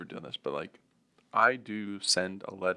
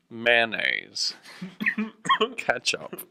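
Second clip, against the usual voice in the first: listing again with a laugh, pitch up; stubbornly repeating the list; mock old man insisting; 3 semitones higher, 3 dB louder, much slower than usual. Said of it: "He's like".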